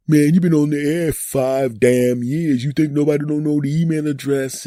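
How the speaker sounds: mocking listener. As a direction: imitate a fed-up fan. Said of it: "Some of you will go".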